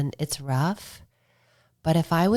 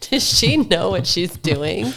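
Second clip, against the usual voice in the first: loud, laughing, half-mocking; laughing disbelief; 4 semitones higher, 7 dB louder than usual. Watching herself on film, laughing loudly at her own ride. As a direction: laugh at your own risky self on film.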